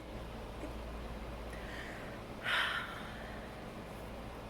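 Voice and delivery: long, breathy exasperated sigh. Real, not acted. Reader sighing at Dean, long and weary.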